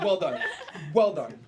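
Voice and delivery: loud, laughing praise. Real, not acted. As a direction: cheer through laughter. Laughing after the howl.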